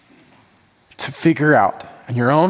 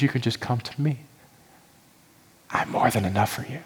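softer, gentle reassuring voice; God speaking tenderly; 8 dB quieter than usual. Acted. Pastor voicing God's tender words to her, soft. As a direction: coax the listener gently home. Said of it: "God's pressing into my heart".

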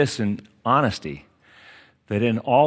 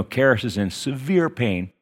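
ordinary talk

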